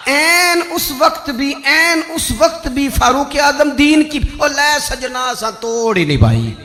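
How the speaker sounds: high, ringing pulpit delivery; fervent sermon sliding into verse; impassioned preaching. Real, not acted. Preaches, moving into Punjabi verse.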